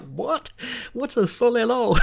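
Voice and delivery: high, laughing bewildered question; confused. Retold or imitated. Retelling his confused reply to the girl, high and laughing. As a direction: ask it high and baffled, half-laughing. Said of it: "I'm like".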